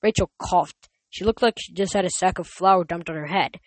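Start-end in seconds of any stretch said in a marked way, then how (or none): none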